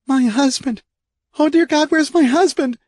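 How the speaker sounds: high, loud anguished cry; desperate wife pleading; frantic woman. A narrator's reading. Kay voiced, frantic.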